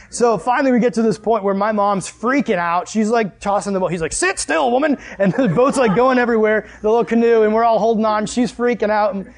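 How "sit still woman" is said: higher-pitched mock scolding; exasperated command. Dad to freaking-out mom in canoe, exasperated. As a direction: imitate a husband ordering his wife about.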